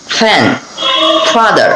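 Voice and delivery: "ফ্যান" and "ফাদার" with a hissed f; lip-teeth f on both words; clear English f. Shown as a demonstration. Teacher drills f words for Bangla learners. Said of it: "এভাবে আমরা বলবো".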